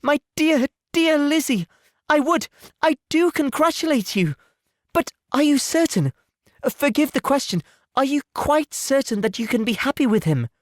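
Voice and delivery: congratulate, then ask gently; tender anxious Jane. Acted.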